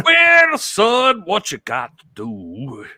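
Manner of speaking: put-on high southern old-timer voice; folksy veteran handing out advice, hammed-up; Nick Bockwinkel. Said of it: "How did Nick Bockwinkel sound".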